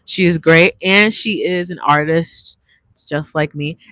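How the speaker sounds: normal talking voice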